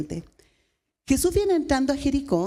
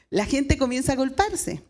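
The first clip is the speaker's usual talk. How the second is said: ordinary talk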